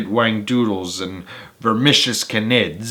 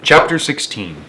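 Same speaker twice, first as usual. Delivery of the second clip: usual speaking voice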